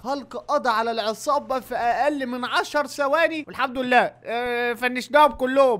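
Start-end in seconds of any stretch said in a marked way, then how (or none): none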